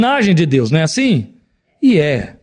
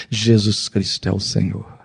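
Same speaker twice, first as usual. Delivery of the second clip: low, soft, slow awed words; reverent confession; 7 semitones lower, 6 dB quieter, slower than usual. Acted. Kneeling people confess Jesus, hushed, low and slow.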